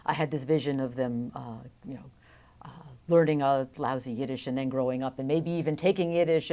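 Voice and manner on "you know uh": usual speaking voice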